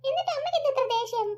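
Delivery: put-on high, small child's voice; little boy voice. Young son answering his mother, high childlike voice. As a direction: play the young son, eager and sweet.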